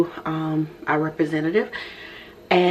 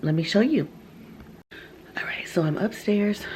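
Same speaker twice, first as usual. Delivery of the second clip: whispering; 4 semitones higher than usual. Real, not acted. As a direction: whisper it, son asleep nearby. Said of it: "I have to whisper".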